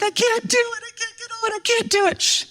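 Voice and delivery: high-pitched mock panic of a little girl; panicking child. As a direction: imitate a kid in a squealing panic. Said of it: "she starts panicking".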